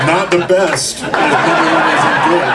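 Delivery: laughing. Real, not acted. Eulogy joke, crowd and speaker laughing.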